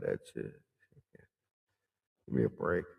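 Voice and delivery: low, soft, dismissive mutter; scoffing under the breath; dismissive aside. Real, not acted. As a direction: drop the voice right down and scoff. Scoffs at being called a threat.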